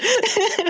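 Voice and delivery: shrieking giggle; high squealing laugh. Real, not acted. Laughing at the impression.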